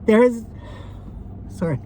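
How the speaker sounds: laughing through tears, apologising; embarrassed crying laugh; real grief breaking through; tearful laugh. Real, not acted. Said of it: "I didn't know I was gonna be this triggered".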